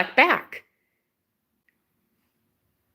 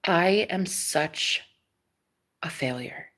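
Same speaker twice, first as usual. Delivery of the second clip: mock dejection; 4 semitones lower, 7 dB quieter, slower than usual. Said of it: "I'm sitting here like".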